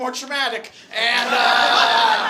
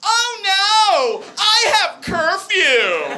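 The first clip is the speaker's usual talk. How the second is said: high, loud, drawn-out wail; panicked outburst; 8 semitones higher, 7 dB louder, much slower than usual. Retold or imitated. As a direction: cry it out in mock horror.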